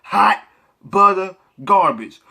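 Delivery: loud, very slow, stretched scornful call; loud drawn-out verdict. Acted. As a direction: bellow the verdict and stretch every word. Final loud put-down.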